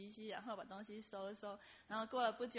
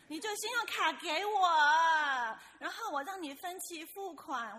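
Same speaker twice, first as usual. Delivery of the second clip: louder, higher hard sell; pushy demand; 6 semitones higher, 13 dB louder than usual. Retold or imitated. Supervisor pushing the narrator to pay now, louder and higher. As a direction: press her to hand over the card. Supervisor pressing for credit card.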